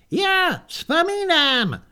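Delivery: put-on high, slow old man's voice; an ancient warrior recalls slowly, high and frail; creaky old man. The old hero answers his old comrade, in a high, slow, frail old voice.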